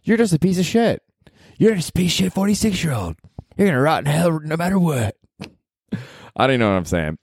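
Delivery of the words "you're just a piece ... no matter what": higher, self-berating voice; harsh inner critic. Retold or imitated. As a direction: tear yourself down like a bully. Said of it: "And then I realized".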